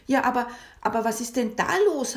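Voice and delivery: loud, fast surprised cry; surprised call. Acted. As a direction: call out in alarm. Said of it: "hab ich gerufen". (überraschter Ausruf)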